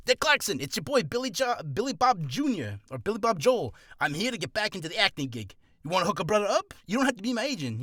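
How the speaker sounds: hammed-up New York accent. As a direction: hail an old pal in a New York accent. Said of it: "Don't know why I'm doing a New York accent".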